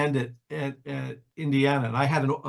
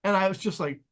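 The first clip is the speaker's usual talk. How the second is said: ordinary talk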